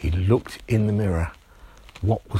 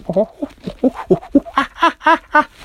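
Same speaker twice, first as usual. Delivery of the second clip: very high, louder monkey-like giggle; girlish giggle; 7 dB louder than usual. Acted. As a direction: answer with a shrill chimp laugh. Little girl answers with laugh.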